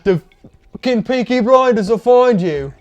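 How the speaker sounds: hammed-up Peaky Blinders British accent; put-on British accent. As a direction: threaten playfully in a fake British accent. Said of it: "should we be talking like this".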